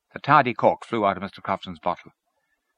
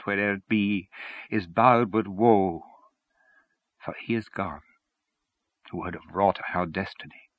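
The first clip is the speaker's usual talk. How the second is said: slow, measured elegy; hushed, evenly paced poem; solemn recitation. A narrator's reading. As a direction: recite it as a grave lament. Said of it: "then began to recite".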